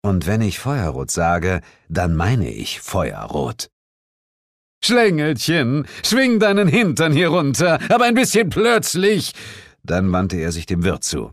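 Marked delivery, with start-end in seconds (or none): 4.8-9.3 loud, high, bossy summons; impatient yell. A narrator's reading. Man yelling up the stairs to his child, impatient. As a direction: order the child down at full voice.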